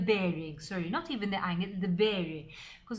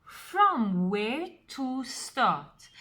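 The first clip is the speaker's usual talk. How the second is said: "from" stretched out and hit louder; slow, emphatic phrase that leans hard on "from"; stress on from; 5 dB louder, much slower than usual. Shown as a demonstration. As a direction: punch "from", then take the rest deliberately. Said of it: "I'm putting emphasis on the word from".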